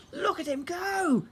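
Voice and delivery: high, slow, delighted shout; excited shout. Acted. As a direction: cheer the dragon on. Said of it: "Calamitous shouted".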